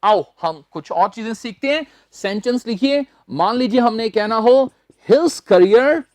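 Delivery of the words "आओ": usual speaking voice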